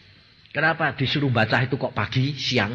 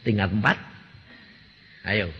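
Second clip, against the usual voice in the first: ordinary talk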